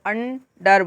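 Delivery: अंडर in two separate beats, अन then डर; split into syllables. Shown as a demonstration. Teacher demonstrating beats to learners, syllable-split अंडर. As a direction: break अंडर slowly after अन. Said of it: "अन एक बार बोला, फिर डर".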